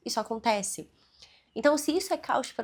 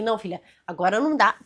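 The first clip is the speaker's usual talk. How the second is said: quick, louder snap of a refusal to the daughter; clipped fast refusal, louder, no patience; curt fast answer; 8 dB louder, faster than usual. Retold or imitated. Distracted mom refuses her daughter's toy request, fast and louder. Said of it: "eu falei muito rápido com ela".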